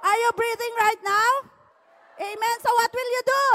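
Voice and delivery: ordinary talk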